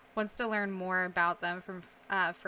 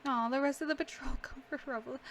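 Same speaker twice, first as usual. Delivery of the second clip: usual speaking voice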